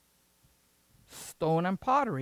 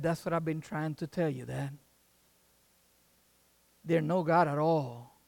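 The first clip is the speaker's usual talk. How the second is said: soft, low, measured payoff; quiet son's reply; 3 semitones lower, 5 dB quieter than usual. Acted. Son finally getting through to his dad, quiet. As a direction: drop right down and make the point gently.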